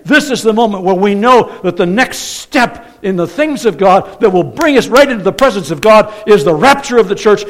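loud, high, driving sermon climax; full-force preaching. Real, not acted. Preacher announcing the rapture to his congregation, full-force preaching. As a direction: proclaim it with building urgency to the room.

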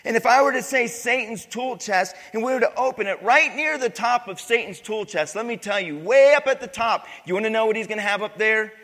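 loud, ringing pulpit voice; fiery preaching. Acted. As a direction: preach it out to the whole room. Preaching at full force.

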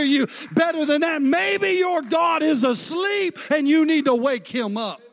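sarcastic taunting, building to a shout; mocking taunt. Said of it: "He begins to mock them".

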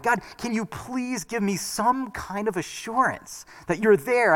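high, soft, slow pleading prayer; imitated tearful plea. Preacher reenacts crying out to God alone. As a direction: beg God quietly, near tears. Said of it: "I'm crying out to God".

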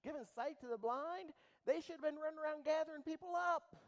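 high, loud preacher's cry; preaching at full force. Acted. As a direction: rouse them, full voice.

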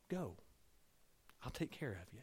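very soft, tender promise; gentle reassurance. Retold or imitated. God calling the family to move, soft and tender. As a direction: hush it like a quiet calling.

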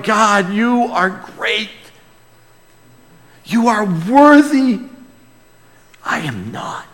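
awed prayer. Retold or imitated. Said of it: "you just were like".

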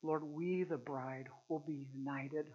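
hushed closing prayer. Real, not acted. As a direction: pray quietly over the church.

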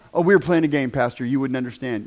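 a hint of a low, offhand teen brushing off the pastor; dismissive kid.

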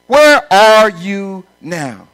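drawn-out, loud, searching; slow loud preaching question. Acted. Pastor asking the congregation, slow and loud. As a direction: stretch it out to the crowd.

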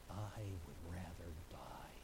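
very slow, breathy whisper; the old preacher's last refusal, whispered; whispered resolve. Acted. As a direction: breathe it out with quiet finality.

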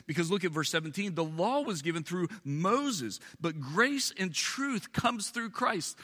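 high, emphatic sermon teaching; insistent preacher laying down doctrine; preaching at full force. Acted. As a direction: drive every point home.

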